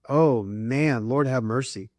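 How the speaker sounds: normal talking voice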